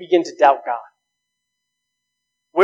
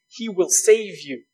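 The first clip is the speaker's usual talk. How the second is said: ordinary delivery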